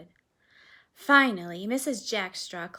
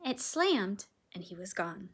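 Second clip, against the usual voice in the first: usual speaking voice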